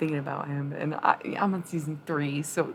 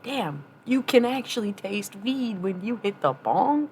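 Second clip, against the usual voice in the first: put-on Southern accent; 5 semitones higher, 3 dB louder than usual. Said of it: "slipping in and out of that damn accent".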